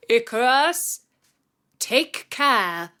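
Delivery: a light call over the shoulder; mild call. A narrator's reading. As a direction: raise the voice a little to reach him. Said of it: "turned round and called".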